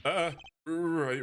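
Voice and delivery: ordinary talk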